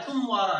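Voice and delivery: तुम मारा as one word, weight on तुम; stress on first syllable. Shown as a demonstration. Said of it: "इसके ऊपर बलाघात आ रहा है". (तुम मारा में तुम पर ज़ोर)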